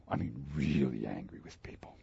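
low breathy whisper; whispered confession. Real, not acted. Preacher confessing his anger to the congregation, whispered.

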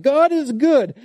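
high, insistent delivery landing on "good"; stress on good. Shown as a demonstration. Preacher showing the psalm's early emphasis, stress on "good". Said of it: "The emphasis is on good".

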